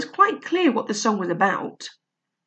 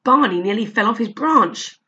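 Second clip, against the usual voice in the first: ordinary delivery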